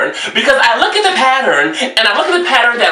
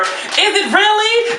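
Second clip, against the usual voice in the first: sarcastic disbelief; 9 semitones higher, slower than usual. Real, not acted. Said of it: "sarcastic drawn-out question".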